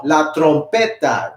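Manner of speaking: la trompeta in Spanish, rolled r; Spanish pronunciation. Shown as a demonstration. Teacher models Spanish for the class, Spanish pronunciation. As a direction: say "la trompeta" slowly with Spanish sounds. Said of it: "In Spanish, you can pronounce it as".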